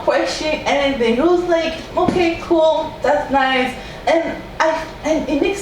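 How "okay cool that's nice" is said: high, breezy, unbothered; husband shrugging off the news, mock casual; mock nonchalant. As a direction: toss it off like nothing's wrong. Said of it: "he was just so cool with it".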